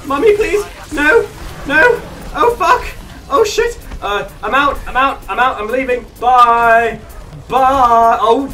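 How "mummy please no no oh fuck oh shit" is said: high, yelping alarm in short bursts; comic high-pitched gamer panic; panicked. Real, not acted. Panicking under attack in game.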